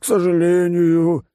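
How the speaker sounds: high, slow, said through a yawn; bored fat oligarch yawning. Acted. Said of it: "зевнул Жердяй".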